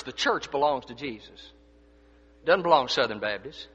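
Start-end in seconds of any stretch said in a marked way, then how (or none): none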